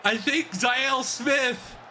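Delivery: high, shouted play-by-play; thrilled shouted commentary; excited play call. Real, not acted. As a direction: call the touchdown at the top of your voice. Calling the winning play live.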